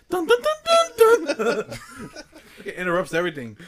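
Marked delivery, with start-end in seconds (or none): 0.1-1.8 high, loud sing-song notes, drawn out; imitated camera jingle. Friend mocks the camera interrupting everything, high mock jingle. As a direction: mimic a jingle blaring out, laughing. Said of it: "his camera, it goes".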